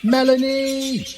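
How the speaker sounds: high, slow call over a fence. A narrator's reading. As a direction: stretch the name out to reach far. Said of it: "called Jojo".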